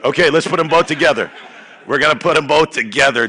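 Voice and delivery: fast, high and loud; excited coach revving the crowd; hype. Real, not acted. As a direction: pump the room up. Hyping the crowd.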